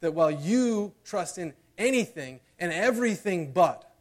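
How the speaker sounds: loud, high climactic preaching; preaching at full force. Acted. Preacher pressing the congregation toward the climax, loud and high. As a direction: drive the sermon home with rising passion.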